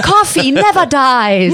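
mock-heroic cry in a Swiss accent, high and slow; Mercy. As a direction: proclaim something trivial as a battle cry. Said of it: "You can be like".

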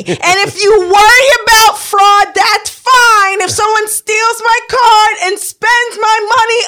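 high, drawn-out furious shout re-enacted; exasperated full-voice phone rant; yelling into the phone. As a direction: yell each word slowly, way up in pitch. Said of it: "I literally yelled into the phone".

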